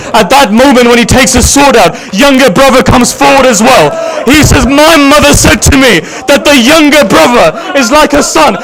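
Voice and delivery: high, keening elegy voice that keeps climbing; mourning recital lifted to a wailing pitch; rising lament. Real, not acted. Rising majlis lament chant.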